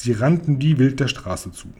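usual speaking voice

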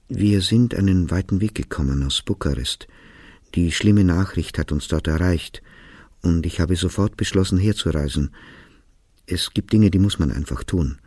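ordinary delivery

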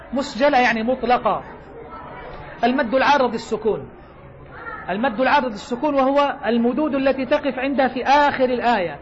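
ordinary delivery

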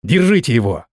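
mildly alarmed call, read with restraint; frightened cry. A narrator's reading. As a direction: call out with a touch of fear. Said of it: "я в страхе закричал". (сдержанный испуганный крик)

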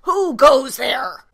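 loud, slow, deep challenge; deep yell. Acted. Paul yelling at the intruder in his deepest voice. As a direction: bellow it to scare the intruder. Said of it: "yelled in his deepest voice".

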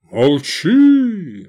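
loud, drawn-out roared order. A narrator's reading. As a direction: roar to silence her. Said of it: "взревел Сикст".

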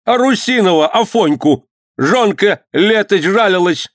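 high-pitched accusing cry; accusing shout. A narrator's reading. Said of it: "крикнул воевода". (обличительный крик высоким голосом)

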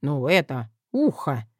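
low, sly smirking answer. Acted. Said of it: "хмыкнул трупорез Петруша".

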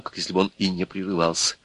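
ordinary delivery

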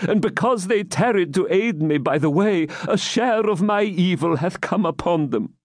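put-on high, beseeching old man; pleading old man. Captive pleading for his friends, beseeching. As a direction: beg for your companions.

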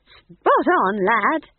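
put-on high, loud, cheery old-woman voice; cheerful. A narrator's reading. Old woman approving the lad's guess, cheerful. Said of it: "said Nanny cheerfully".

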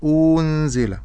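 أنزل with a stretched hamza and plain open nun, shown as an error; wrong demo. Shown as a demonstration. Teacher showing a student the wrong reading of أنزل. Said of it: "فهذا خطأ".

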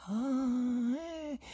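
high, drawn-out "ah-eh" of distaste; grimacing groan. A narrator's reading. Guest hearing the poet's name, high distasteful groan. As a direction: let out a long, high, pained noise. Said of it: "fece l'ospite con una smorfia".

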